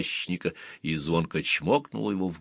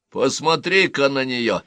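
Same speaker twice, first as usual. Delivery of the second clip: exclaiming; 6 semitones higher, 4 dB louder than usual. Acted. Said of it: "воскликнул Гэт".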